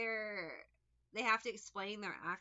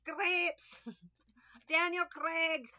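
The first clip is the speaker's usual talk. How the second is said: slow, higher laughing Scottish "Crepes, Daniel Craig"; mock Scottish accent; 6 semitones higher, 5 dB louder, much slower than usual. Distracted reader repeating her Scottish bit, laughing mock accent. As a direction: giggle through the fake Scottish accent. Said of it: "Scottish accent".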